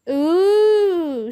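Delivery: drawn-out high teasing ooh. A narrator's reading. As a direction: tease them singsong. Kid teasing the couple.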